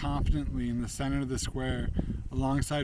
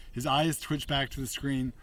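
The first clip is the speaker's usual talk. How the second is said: ordinary delivery, nothing marked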